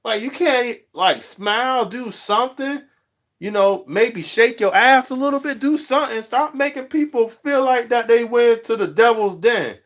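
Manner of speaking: high pleading call-out; exasperated scolding. Retold or imitated. Vlogger telling scowling streamers to smile, high and exasperated. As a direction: beg them to lighten up. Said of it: "I'm like".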